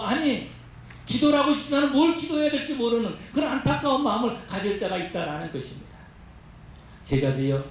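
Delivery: ordinary talk, nothing marked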